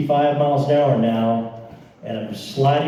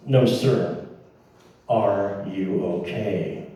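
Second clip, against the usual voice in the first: slow, lowered, toneless question; monotone; 5 semitones lower, 3 dB quieter, slower than usual. Retold or imitated. Stranger checking on a driver in a ditch, monotone. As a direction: ask it slowly with no expression. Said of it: "He said this in a very monotone voice".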